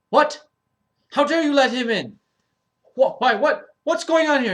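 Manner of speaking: high, loud outraged yell; incensed shout. Acted. Doctor Goldstein yelling at Gabriel, high and furious. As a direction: explode at the unfairness, shouting. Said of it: "Doctor Goldstein gets incensed".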